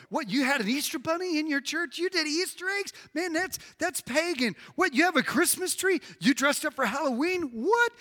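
high, incredulous questioning; mock scandalised. Preacher mimics critics of Easter and Halloween, mock appalled. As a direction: pile up appalled questions, pitch climbing high. Acted scandal over church holiday customs.

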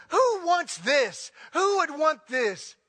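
high, slow scornful question; mocking the speaker. Mocking the rose speaker's judgmental question, slow and high. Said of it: "the speaker was going".

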